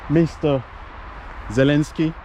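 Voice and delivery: ordinary talk, nothing marked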